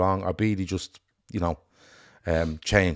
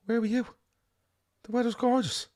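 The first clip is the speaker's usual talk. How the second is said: much higher put-on voice, softer; imitating a cheery caller; 5 dB quieter than usual. Friend relaying Madge's weather report, in a high soft mock voice. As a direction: pitch it way up and soft, like a chirpy friend. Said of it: "Madge was up there earlier and she said".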